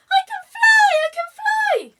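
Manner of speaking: very high, very loud squeal; squeaking at the top of his voice. Acted. Penguin discovering he can fly, shrieking high and loud. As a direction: squeal it with pure joy. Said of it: "squeaking at the top of his voice".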